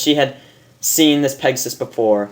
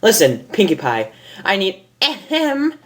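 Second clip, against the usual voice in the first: higher, brash tomboy pony; Rainbow Dash voice; 7 semitones higher than usual. Acted. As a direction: push it up like a cocky pegasus. Rainbow voiced in fan story.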